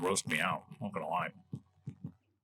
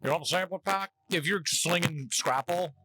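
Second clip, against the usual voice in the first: light mock southern accent, pitched up; 4 semitones higher than usual. Scrapple company on the phone offering samplers, mock southern accent. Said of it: "make like they have a southern accent".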